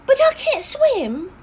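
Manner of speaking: high, loud, panicky little hare; scared protest. Acted. Hopper balking at the river, a high, loud, frightened protest.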